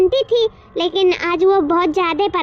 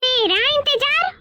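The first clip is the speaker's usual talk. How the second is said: incredulous, pitched high and rising; surprised question; 6 semitones higher than usual. Acted. Woman to relative who says he waited for her, surprised. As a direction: echo it back in disbelief. Told he was waiting for her.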